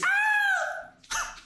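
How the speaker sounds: high, loud mock shriek; hammed-up female scream; girlfriend's scream. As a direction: shriek in fright, high-pitched. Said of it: "your girlfriend screams".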